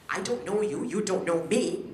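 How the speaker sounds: ordinary talk, nothing marked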